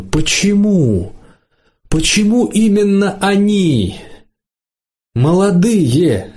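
high, drawn-out indignant questioning; slow, high-pitched protest without shouting. A narrator's reading. Mother questioning the one on the icon, indignant. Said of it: "возмущённо спрашивает".

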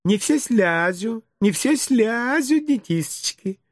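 high, slow, lisping coo; smiling teacher correcting. A narrator's reading. Teacher gently correcting her pupils, slow high cooing. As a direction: coo it slowly with babyish R's. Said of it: "с улыбкой поправила воспитательница".

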